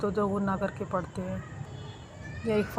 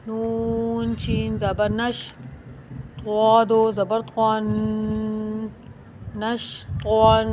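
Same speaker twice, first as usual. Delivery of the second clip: slow spelling recitation; slower than usual. Shown as a demonstration. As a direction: spell it out slowly, letter by letter. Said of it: "spelling out the letters".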